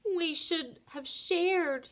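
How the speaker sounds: high, slow and sorrowful; sad meow. A narrator's reading. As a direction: let the regret drag out slowly. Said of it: "Spotty meowed sadly".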